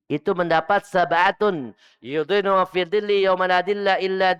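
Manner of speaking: loud, high hadith recitation and preaching; forceful preaching. Real, not acted. Preacher quoting a hadith to the congregation, forceful.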